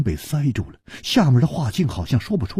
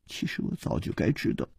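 压低声音自言自语，末句说快; 低着头喃喃地说; 喃喃低语; 6 dB quieter, faster than usual. A narrator's reading. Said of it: "喃喃地说". (公主低头喃喃自语)

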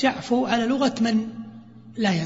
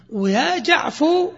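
"يا جعفو" drawn out, higher and slower; slow demo form; 5 semitones higher, much slower than usual. Shown as a demonstration. المعلم يمد "يا جعفو" عاليًا وببطء. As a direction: stretch "يا جعفو" high and slow. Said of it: "على لغة من لا ينتظر".

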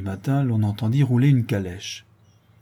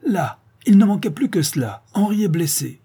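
higher, louder exclamation of exasperation; angry cry, pitched up and louder; exasperated outcry; 8 semitones higher, 4 dB louder than usual. Acted. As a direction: general bursts out at the bad news. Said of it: "et le général s'écria".

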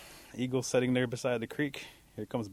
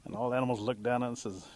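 ordinary delivery

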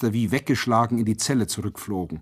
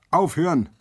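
high, raised menacing command; threatening order; 6 semitones higher than usual. A narrator's reading. Soldier below warns singing prisoners, threatening. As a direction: threaten them from down in the yard. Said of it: "drohte es noch einmal".

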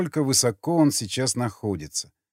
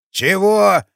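put-on deaf old man; 6 semitones higher, 6 dB louder than usual. A narrator's reading. Deaf old guard asking.

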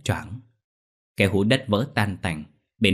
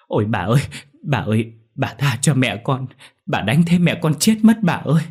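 pleading cry rising higher and louder; tearful begging; 5 semitones higher, 5 dB louder than usual. A narrator's reading. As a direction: plead for mother's life, building to a wail. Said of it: "khóc lóc van xin".

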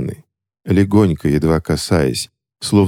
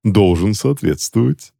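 pompous; 4 semitones higher than usual. A narrator's reading. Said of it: "важно заявил Джозеф".

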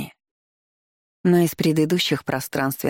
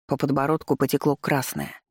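usual speaking voice

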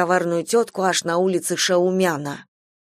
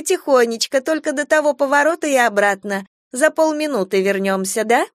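sweet reassuring; 7 semitones higher than usual. Acted. Stranger reassures grandmother.

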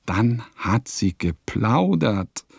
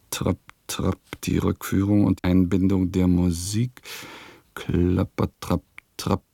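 hoofbeat chant; 3 semitones lower than usual. Acted. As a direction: tap out the trotting horses with the words. Imitating rhythmic hoofbeats on the road.

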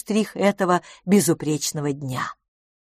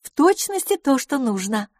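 a higher character voice; lightly voiced lady friend; 8 semitones higher than usual. Approving a flower on her guest's dress, lightly voiced lady.

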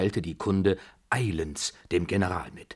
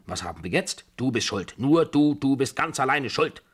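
high, loud, building blame; heated, scolding accusation; accusing; 7 semitones higher, 5 dB louder than usual. A narrator's reading. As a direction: hammer the blame onto him. Babuschka blames the narrator.

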